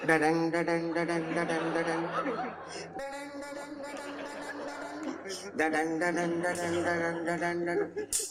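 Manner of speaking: soft, monotone repeated "dadang" sting; mock soap-opera background score, hushed and repetitive; imitated suspense music. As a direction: mimic a TV serial dragging out suspense. Mimics serial's suspense music.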